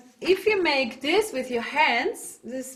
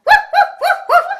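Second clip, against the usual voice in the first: very high, loud head-voice yips; squeaky little woofs; small dog bark; 12 dB louder, faster than usual. Shown as a demonstration. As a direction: yap like a tiny dog. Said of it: "small dog".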